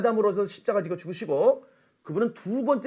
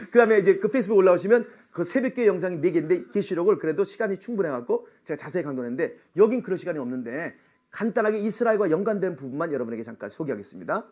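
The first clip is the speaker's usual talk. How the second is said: ordinary talk, nothing marked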